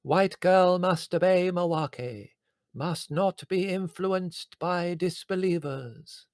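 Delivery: put-on a slow, high Indian accent for the spirit guide. Medium channelling her spirit guide Mawake in a slow Indian accent. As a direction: stretch each word out in a put-on Indian accent. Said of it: "strong Indian accent".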